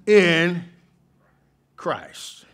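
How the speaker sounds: rising pulpit fervor, loud and ringing; full-force preaching. Acted. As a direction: lift the church, proclaim it.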